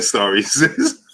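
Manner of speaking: high, loud, breaking into laughter; laughing through words. Real, not acted. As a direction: read it out while cracking up. Cracking up while reading a comment.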